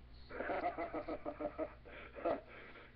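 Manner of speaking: breathy high laugh; amused unvoiced laugh; real laughter. Real, not acted. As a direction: burst out laughing at the story. Host laughs at the prayer.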